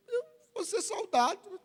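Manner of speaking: high, soft comic whimper; tiny high voice, playing the reluctant recruit; mock whimper. Voices himself answering his mother.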